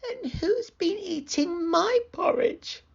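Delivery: put-on very high little baby bear; small, high-pitched Baby Bear; tiny squeaky voice. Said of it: "squeaked Baby Bear in his tiny voice".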